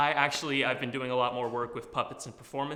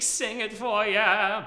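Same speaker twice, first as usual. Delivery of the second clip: character voice; 8 semitones higher, 7 dB louder, much slower than usual. Shown as a demonstration. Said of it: "throw on a character voice".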